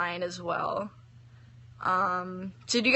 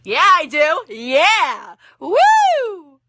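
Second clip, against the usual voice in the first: excited cheer; 7 semitones higher, 9 dB louder, much slower than usual. Real, not acted. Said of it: "excited answer".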